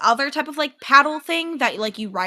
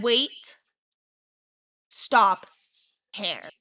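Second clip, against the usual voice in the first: calm, slow imitation; 3 semitones lower, much slower than usual. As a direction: mimic how calmly it actually came out. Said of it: "you said it very calmly".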